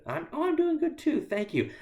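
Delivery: high, bright quick reply; breezy cheerful small talk; chirpy American reply. Retold or imitated. Mimicking quick American greeting exchange, chirpy high voice. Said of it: "I'm used to".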